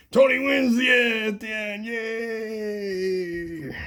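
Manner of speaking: mock victory cheer. Toy fight victory cheer.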